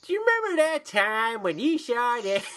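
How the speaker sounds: high-pitched mock cartoon voice; Family Guy parody. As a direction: send it up as a cartoon flashback setup. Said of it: "just like Family Guy".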